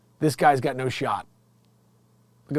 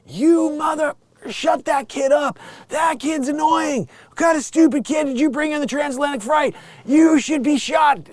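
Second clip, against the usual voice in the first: angry passengers yelling; 10 semitones higher than usual. Retold or imitated. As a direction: shout down the guy with the crying baby.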